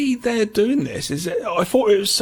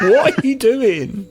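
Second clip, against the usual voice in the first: laughing mock teacher; 3 semitones higher, 4 dB louder, slower than usual. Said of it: "The teacher must be like".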